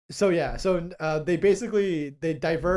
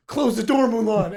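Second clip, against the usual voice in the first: raised, pressing plea; urgent high command; 5 semitones higher, 8 dB louder than usual. Retold or imitated. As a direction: push it out urgently. Retold hero, contrasting take.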